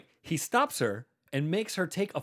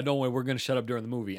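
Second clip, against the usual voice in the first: ordinary delivery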